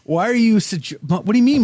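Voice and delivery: high-pitched spluttering indignation, put on; teasing mock offence. Host teasingly challenges listener about his brain, mock offence. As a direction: stammer in playful affront, pitch jumping up. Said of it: "I'm teasing".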